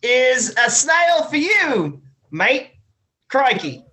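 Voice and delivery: drawn-out, badly faked Cockney-Aussie accent; bad mixed accent. Drunk player joins in as Smiles, bad British-Australian accent. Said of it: "just hearing another accent is enough".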